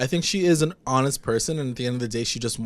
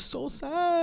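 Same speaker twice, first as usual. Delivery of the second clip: mock whine; 5 dB quieter than usual. Real, not acted. Moaning about a sad scene.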